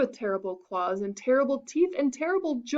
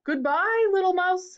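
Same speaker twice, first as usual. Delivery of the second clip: higher, louder hurried farewell; hasty fox; 9 semitones higher, 6 dB louder than usual. Acted. Fox hurries away from the mouse, nervous. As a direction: rush off nervously.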